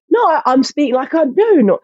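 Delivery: high, mangled mock English accent; botched English accent. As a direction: imitate a hopelessly faked cockney accent.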